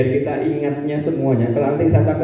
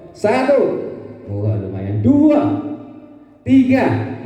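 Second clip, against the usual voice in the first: calling out counts; 8 semitones higher, much slower than usual. Shown as a demonstration. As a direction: drill the audience with sharp calls. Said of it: "Agak cepat".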